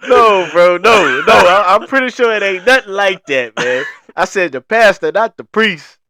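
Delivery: loud, high words through real laughter; can barely get it out for laughing; cracking up at a friend's joke, talking through the laughter; laughing hard. Real, not acted.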